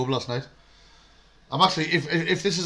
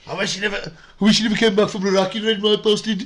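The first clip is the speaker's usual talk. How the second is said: higher, louder jeering fan voice; mock rival fan; 7 semitones higher, 4 dB louder than usual. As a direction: taunt like a hostile away supporter. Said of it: "wishing I died in Iraq".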